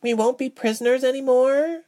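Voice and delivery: high-pitched, hopeful longing; mousy, wishful question; wistful. A narrator's reading. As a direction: ask wistfully in a high little voice. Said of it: "Mouse said in a wistful manner".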